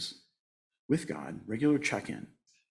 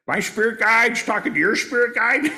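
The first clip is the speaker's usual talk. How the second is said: much higher, louder smug mock voice; mocking know-it-all medium; 11 semitones higher, 7 dB louder than usual. Mocking a showy medium bragging to others, high and loud. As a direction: ham up the boastful medium. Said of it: "I've been this guy".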